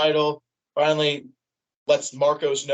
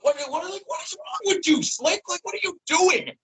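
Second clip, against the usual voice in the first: much higher, pleading disbelief at the betrayal; incredulous outcry; 14 semitones higher than usual. Retold or imitated. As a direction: cry out in disbelief at a friend's betrayal. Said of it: "And I'm just like".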